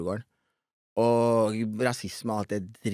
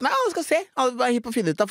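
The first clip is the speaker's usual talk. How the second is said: excited retelling; 12 semitones higher, much faster than usual. Retold or imitated. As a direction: build the hype as you tell it. (ivrig, høyt oppspilt fortelling)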